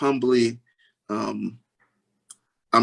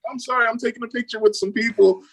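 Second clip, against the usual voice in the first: quick, high-pitched retold excuse; mock apology; 10 semitones higher, faster than usual. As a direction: playfully excuse yourself to impatient kids. Dad's excuse to his hungry kids.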